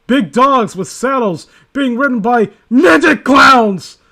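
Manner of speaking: slow, loud hammed-up declaration; slow mock-dramatic yell; mock dramatic shout. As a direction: belt it out like it's terrifying. Overdramatic reading of absurd line.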